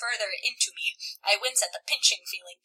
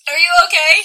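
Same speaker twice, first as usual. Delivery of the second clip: mock overwrought; 6 semitones higher, 15 dB louder than usual. Voicing the boyfriend.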